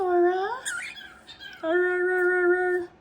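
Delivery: loud sing-song calling of a name. Real, not acted. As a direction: call the pet loudly across the room. Calling the bird loudly.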